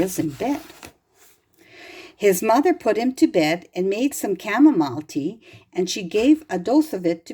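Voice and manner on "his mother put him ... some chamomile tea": ordinary delivery, nothing marked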